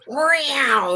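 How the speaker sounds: high mock meow; cat meow. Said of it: "does the Halloween kitty".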